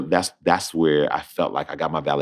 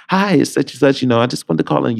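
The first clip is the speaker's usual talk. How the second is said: a higher, bright chipper caller voice; 9 semitones higher than usual. Retold or imitated. The caller announcing the part, chipper. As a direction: play the upbeat caller, pitched up. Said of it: "He's chipper".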